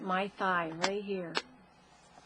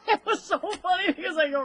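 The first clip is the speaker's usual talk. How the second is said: laughing; 9 semitones higher, 6 dB louder than usual. Real, not acted. Laughing while retelling.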